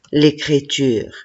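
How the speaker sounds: ordinary talk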